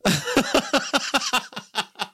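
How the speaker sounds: high-pitched burst of laughter; real laugh. Real, not acted. Laughs at the played clip.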